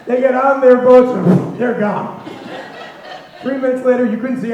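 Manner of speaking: can't hold back the laugh at the punchline. Real, not acted. Punchline of the smoke story.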